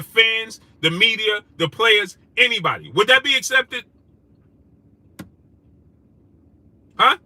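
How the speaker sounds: heated rhetorical rant. Real, not acted. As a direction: demand an answer from the chat.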